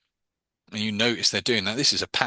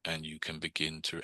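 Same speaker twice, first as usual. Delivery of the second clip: hushed, low robotic drone, drawn right out; slow monotone induction; 5 semitones lower, 11 dB quieter, slower than usual. Retold or imitated. Mimicking a fifties hypnotist relaxing a subject, slow monotone drone. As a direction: imitate a sleep-inducing old hypnosis tape. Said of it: "it's a slow, slow induction".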